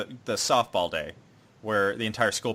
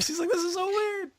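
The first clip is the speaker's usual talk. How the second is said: very high mock-girlish whine; flustered squeal. Voicing the girl weirded out, high flustered squeal.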